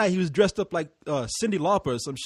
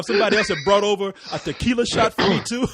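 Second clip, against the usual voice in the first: usual speaking voice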